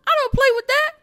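high, loud outburst; loud high refusal; outraged shout. Real, not acted. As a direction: yell it in disgusted refusal.

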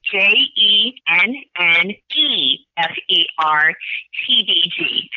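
slow, letter by letter; spelling it out slowly. Shown as a demonstration. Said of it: "So I'll spell it slowly".

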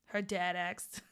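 ordinary delivery

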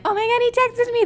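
very high-pitched mock squeal. Re-enacting news to unimpressed friends, excited squeal. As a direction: gush it in a giddy squeal. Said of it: "brought down my high of the moment".

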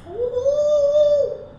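high stretched wolf howl. Pretend.

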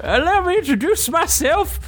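put-on high creaky old man voice; proud old prospector introducing himself; Lenny the old mage. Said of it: "As Lenny the mage takes a bow".

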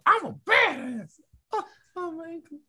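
high boast fading to laughing whimper; hammed-up badass collapsing into giggles; mock brag, laughing. Co-host mocking Tetsuo, high, trailing off laughing.

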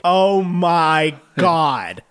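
very slow, low mock-stunned reaction; imitating slow, heavy disbelief. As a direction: draw each word right out, appalled. Retelling her stunned reaction.

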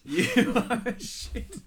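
laughing. Real, not acted. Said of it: "This is the best comment".

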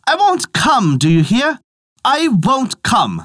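high, defiant refusal; calling out loudly. A narrator's reading. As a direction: call it out high and firm, slowing on the last refusal. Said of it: "he called out in a loud voice".

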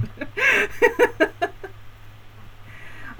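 breathy laugh, high and bright; high burst of laughter. Real, not acted. Laughing at cohost's cowboy bit.

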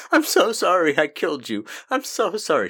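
high, loud, over-the-top apology; mock loud confession. Cohost mocking an unlikely graveside confession, loud and hammed-up. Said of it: "she's not gonna just sit there and be like".

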